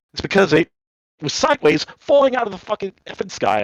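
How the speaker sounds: high-pitched, worked-up explanation; retold exasperated rant. Passenger retelling to his wife how the plane fell, exasperated. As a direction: relive the outrage, voice climbing high.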